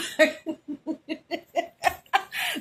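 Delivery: bright rising laugh at her own joke; real laugh. Real, not acted. Laughing at her Texas Bama joke to viewers, rising laugh. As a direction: let a real laugh rise out.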